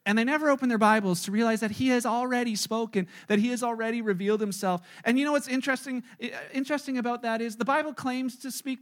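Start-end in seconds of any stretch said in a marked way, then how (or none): none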